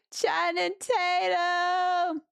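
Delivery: excited. Real, not acted. Excited shout at donor name.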